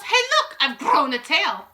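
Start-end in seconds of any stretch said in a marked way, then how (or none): 0.0-0.6 high, loud startled call; girl calling out in alarm; yelling. A narrator's reading. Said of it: "She yells".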